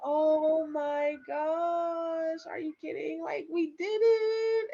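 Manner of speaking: high, drawn-out disbelieving elation; overwhelmed joy. Retold or imitated. Mother to husband just after birth, high stretched-out joy. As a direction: stretch it out, nearly tearful with relief.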